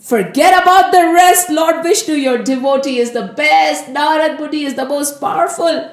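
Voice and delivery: loud, high burst of self-praise; loud boasting. Acted. Narad Muni hails himself before Lord Vishnu, boasting loudly. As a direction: proclaim your own greatness, full of bluster.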